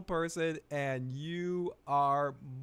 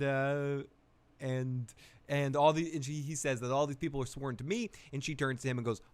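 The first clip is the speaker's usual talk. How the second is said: usual speaking voice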